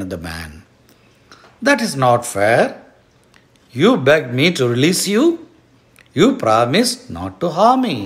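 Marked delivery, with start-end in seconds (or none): 1.7-8.0 louder, rising indignant appeal; frightened man protesting unfairness. A narrator's reading. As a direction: plead your case with raised voice. Man protests the broken promise.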